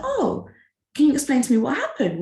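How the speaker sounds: high, fast incredulous question; surprised. Retold or imitated. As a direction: sound taken aback. Her shocked reply to client.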